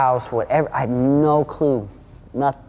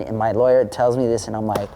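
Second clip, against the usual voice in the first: ordinary talk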